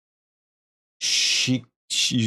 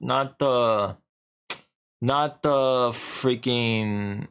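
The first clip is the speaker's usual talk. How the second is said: usual speaking voice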